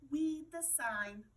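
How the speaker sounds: timid, slow little squeak. A narrator's reading. Tiny mouse squeaks to the playground. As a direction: say it small and scared, slowly. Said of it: "and squeaked".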